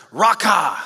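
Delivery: mock scornful jab; contemptuous insult. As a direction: spit out the insult Raca. Insult quoted in sermon.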